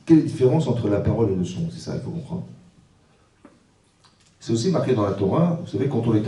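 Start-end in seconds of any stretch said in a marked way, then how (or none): 1.7-2.4 whispered aside. Real, not acted. Rabbi whispering an aside to the congregation. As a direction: drop to a breathy whisper.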